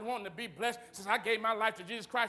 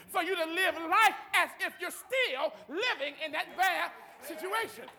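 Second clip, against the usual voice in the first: higher, emphatic sermon delivery; fervent preaching; 6 semitones higher than usual. Real, not acted.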